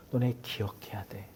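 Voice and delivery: hushed, urgent whisper; whispered plea. Acted. Preacher whispering to the congregation, an urgent plea. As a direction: lean in and breathe the words.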